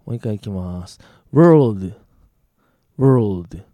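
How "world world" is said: very loud, isolated "world" with curled r then l; pronunciation of WORLD. Shown as a demonstration. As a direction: punch out "world" for listeners to identify. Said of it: "今のが世界だよね".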